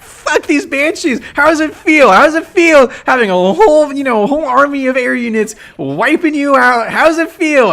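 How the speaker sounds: high, taunting jeer at the enemy; mock trash talk. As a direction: gloat like you're rubbing it in their face. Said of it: "I'm talking so much shit".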